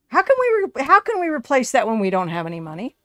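stunned gamer shouting at his broke household; exasperated outburst. Real, not acted. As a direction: blurt it out like the money is gone. Panicking over zero dollar budget.

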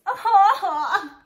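high, loud burst of giggling; delighted laugh. Real, not acted. Fan reacting to Cardan's letter, delighted laugh.

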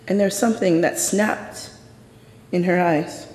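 normal talking voice

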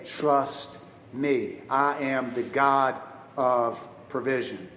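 slow, soft, solemn voice of God. Retold or imitated. God speaking to him.

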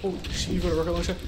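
quick, high startled cry; alarmed. Real, not acted. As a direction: blurt it out in alarm. Alarmed at enemy rocket launcher.

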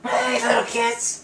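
put-on high, slow raspy old lady. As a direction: croak it like a crabby granny. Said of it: "Sound like a raspy old lady".

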